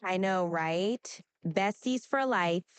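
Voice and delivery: put-on drawn-out, swooping Valley Girl talk; Valley Girl accent. Shown as a demonstration. Assistant giving a requested shout-out, Valley Girl accent. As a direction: gush like a Valley Girl, stretching words. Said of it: "in a Valley Girl accent".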